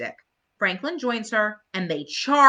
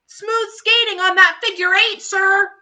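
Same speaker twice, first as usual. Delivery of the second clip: higher, louder admiring call; Marcy cheering; 13 semitones higher, 8 dB louder than usual. Acted. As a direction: shout praise across the ice.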